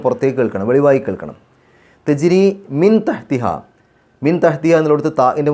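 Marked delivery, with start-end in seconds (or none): 2.1-3.4 slow, drawn-out chanted recitation; measured Quranic chant. Shown as a demonstration. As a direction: recite it slowly with tajweed.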